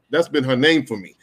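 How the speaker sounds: ordinary talk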